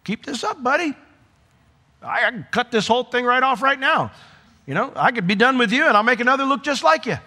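high, mock stern father voice; a hammed-up God warning his kid. God scolds Will, pretend stern father voice. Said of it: "looks at Will sternly and says".

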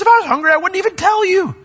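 high and loud, voicing God with fire; preaching at full force. Pastor voicing God to the church, high loud preaching. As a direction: thunder God's words over the congregation.

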